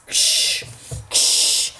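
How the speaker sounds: soft hissed icy wind noise; wind sound. Shown as a demonstration. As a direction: blow like a freezing wind. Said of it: "That's the sound of the wind".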